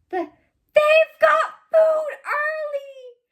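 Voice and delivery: very high, loud, stretched squeal; hammed-up squeal. A narrator's reading. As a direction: squeal it out with overblown delight. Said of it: "he squealed".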